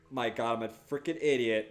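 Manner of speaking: ordinary talk, nothing marked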